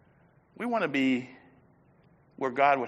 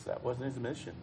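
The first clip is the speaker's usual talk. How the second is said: usual speaking voice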